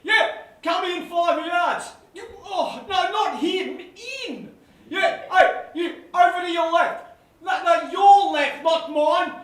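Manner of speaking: exasperated captain bellowing at fielders; captain shouting. Acted. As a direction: yell field placings at a fielder. Captain yelling field placings.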